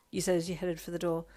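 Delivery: usual speaking voice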